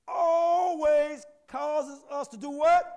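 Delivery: "always" drawn out long, high and very loud; stress on always. Shown as a demonstration. Preacher demanding emphasis from the church, "always" held long and loud. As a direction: hit "always" hard and stretch it slowly. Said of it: "you gotta put some emphasis on that word always".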